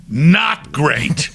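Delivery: NOT hit high and loud; emphatic verdict. Retold or imitated. Director tells the singer his take failed, NOT emphatic. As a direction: punch NOT, then let GREAT follow.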